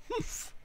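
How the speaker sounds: very high, soft huff; short, snooty humph; disdainful humph. A narrator's reading. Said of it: "humped a voice".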